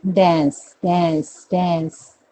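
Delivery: American "डांस" as "dans" with open E-like A; drilled American "डांस", front vowel; American pronunciation. Shown as a demonstration. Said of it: "American क्या बोलेंगे?".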